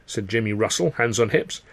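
ordinary delivery, nothing marked

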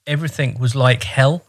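ordinary talk

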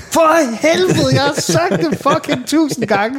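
put-on high, slow, exasperated yell at the child. Parent yelling at son who hit his head, exasperated. Retold outburst at hurt son.

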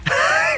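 sudden high cackle; high burst of laughter. Real, not acted. Laughs at own fly joke.

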